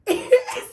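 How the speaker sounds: quick high laugh on breath; breathy high laugh. Real, not acted. Teller laughing at the psych ward punchline, breathy laugh. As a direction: let a soundless laugh slip out.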